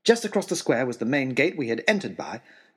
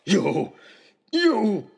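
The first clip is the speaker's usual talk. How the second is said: raging; 8 semitones higher, 4 dB louder than usual. Acted. Trapper raging at host.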